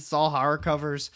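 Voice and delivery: ordinary delivery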